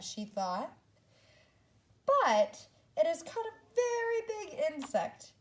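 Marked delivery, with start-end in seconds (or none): 2.1-5.3 much higher and louder character voice; high eager Zoe voice; excited character voice. Acted. Zoe's quoted thought, pig web.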